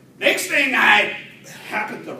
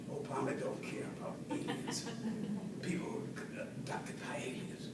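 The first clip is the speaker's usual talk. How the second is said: hushed, voiceless reply; whispered quote; 18 dB quieter than usual. Retold or imitated. Collection agency's answer, whispered softly. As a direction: whisper it like a secret.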